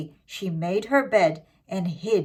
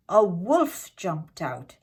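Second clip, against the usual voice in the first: plain reading voice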